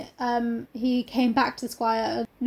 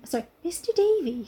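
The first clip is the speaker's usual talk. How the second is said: higher, softer Cornish heroine; lightly acted Cornish voice; Cornish accent; 5 semitones higher, 4 dB quieter than usual. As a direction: give Mary a Cornish accent. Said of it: "more of those accents".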